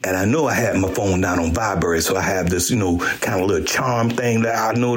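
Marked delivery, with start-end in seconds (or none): none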